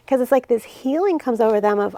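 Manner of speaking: normal talking voice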